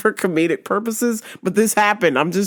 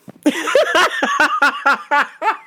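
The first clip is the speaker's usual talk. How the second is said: high rapid cackle; real sustained laughing fit; helpless laughter; 7 semitones higher than usual. Real, not acted. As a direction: burst out laughing hard. Cracks up at own story.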